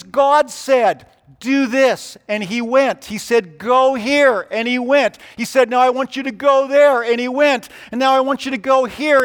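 emphatic pulpit narration; forceful preaching. Real, not acted. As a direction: drive the story on in a high, strong preacher's voice.

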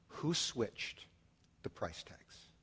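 very soft, trailing almost to nothing; quiet, grave rebuke voiced for God; hushed divine question. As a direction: let the question sink to a near whisper. God's hushed question in the sermon.

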